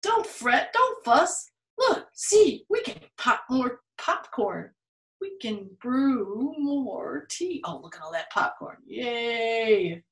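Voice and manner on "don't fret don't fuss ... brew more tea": high little mouse voice; squeaky mouse. Acted. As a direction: comfort the bear as a tiny mouse. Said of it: "And mouse squeaks".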